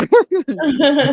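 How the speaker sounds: a quick high laugh at his own brag; short laugh. Real, not acted. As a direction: laugh it off lightly.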